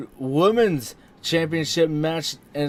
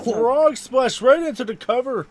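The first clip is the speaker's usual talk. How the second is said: excited; 9 semitones higher than usual. Real, not acted. Commentator calls big finish.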